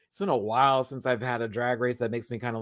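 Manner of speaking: ordinary talk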